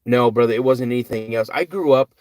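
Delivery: ordinary delivery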